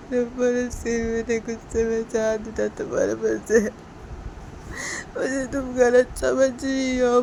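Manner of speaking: put-on hurt young man swearing he's innocent; pleading boyfriend. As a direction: beg her to believe you, slowly. Sanjay pleading again.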